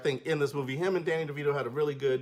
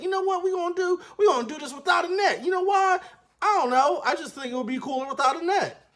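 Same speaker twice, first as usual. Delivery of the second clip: cocky headmaster; 13 semitones higher than usual. Retold or imitated. Headmaster deciding to go without a net, high and cocky. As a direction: dare them gleefully. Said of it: "the headmaster's like".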